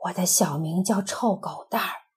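hush, share a secret name; secret whisper. Acted. Doctor whispering a secret nickname to a child, whispered. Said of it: "悄悄地告诉来咨询的孩子".

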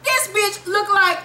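high-pitched, exasperated mockery; incredulous rant. Real, not acted. Incredulous rant about adored character.

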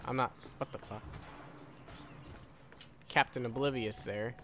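ordinary delivery

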